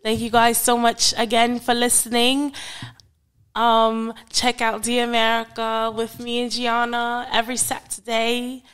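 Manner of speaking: hammed-up British podcast host; British accent. Co-host introducing friends and thanking listeners, British accent. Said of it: "close out in your British accent".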